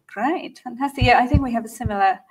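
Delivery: normal talking voice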